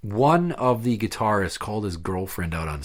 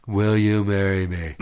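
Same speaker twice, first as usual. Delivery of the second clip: slow, low mock proposal; imitating a dreary marriage proposal; monotone proposal; 4 semitones lower than usual. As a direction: drone it out lifelessly. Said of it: "very monotone".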